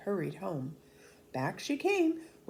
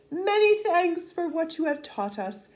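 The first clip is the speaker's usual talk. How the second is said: much higher, louder thankful villagers; grateful peasants; 11 semitones higher, 6 dB louder than usual. Acted. Peasants thanking soldiers at the send-off, joyfully grateful. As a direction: beam your thanks at the soldiers.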